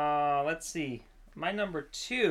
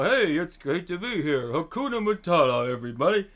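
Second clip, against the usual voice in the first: loud, higher put-on greeting to a crowd; hammed-up parade-float host; Ben Franklin; 4 semitones higher, 5 dB louder than usual. Giant Ben Franklin riding a float hails the crowd, hammed-up cheer. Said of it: "he's saying".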